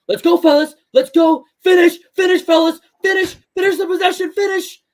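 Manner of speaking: coach yelling. Retold or imitated. Imitating teammate Kevin yelling at the fellas to finish. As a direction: bark it at the players like a court-side yell. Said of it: "I'm screaming the same".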